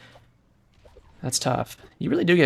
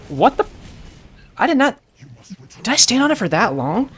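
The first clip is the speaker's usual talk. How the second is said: shocked disbelief; 12 semitones higher, 7 dB louder than usual. Real, not acted. Shocked at accidental teleport.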